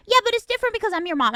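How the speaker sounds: a high, hurried mock mom; tossing off a fast, high-pitched mom reply; mom's voice, quick and high. Mom brushing off her daughter, quick and high. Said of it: "It's like".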